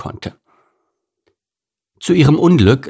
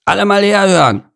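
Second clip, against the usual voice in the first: higher louder call to attention; 11 semitones higher, 4 dB louder than usual. Acted. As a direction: call the crowd to listen. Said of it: "rief er".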